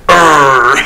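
short playful growl; mock growl. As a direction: growl it like a snarl. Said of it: "you're like".

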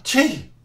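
loud, high sneeze. Acted. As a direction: sneeze it out sharply. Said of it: "Er nieste".

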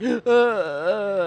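hammed-up crying noises; mock sobbing. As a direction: imitate a girlfriend wailing in tears. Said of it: "She was in tears, she was like".

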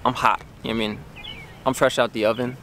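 ordinary talk, nothing marked

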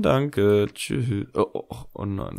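ordinary talk

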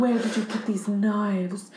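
slow, over-enunciated breathy starlet; lightly voiced Marilyn, every word crisp. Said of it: "when you try to talk like Marilyn, you're, like, over-enunciating".

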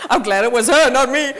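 loud, laughing through the joke; laughing relief. Real, not acted. Teasing friend about the kiss, loud laughing relief.

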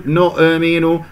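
نؤمن with a small extra vowel echoed after the stop; bounced hamza. Shown as a demonstration. Teacher shows another error, bounced hamza. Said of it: "قلقلتها".

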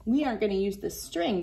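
ordinary talk

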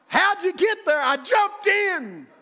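high, loud comic exchange; yelled retelling, pushed high; hammed-up shout. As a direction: holler question and answer up a well. Acts out exchange at the well.

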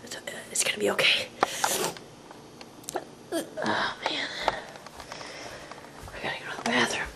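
fast, hushed whisper. Acted. Alone at night, sneaking to the bathroom in a whisper. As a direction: creep along whispering.

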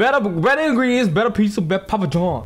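mock Italian accent. Mocking the friend's pizza ad voice, Italian accent. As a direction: ham up a Mario-style ad read. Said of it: "You sound like fucking Mario".

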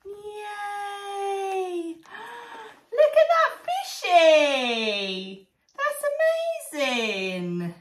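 high, slow, gushing delight over the fish; excited praise. Acted. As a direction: gasp and gush like it's a masterpiece. Praising toddler's handprint fish.

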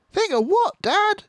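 put-on very high, loud child's voice; eager fox cub. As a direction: ask like a little fox. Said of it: "small foxes asking".

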